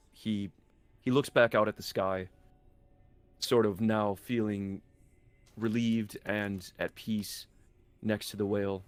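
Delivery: normal talking voice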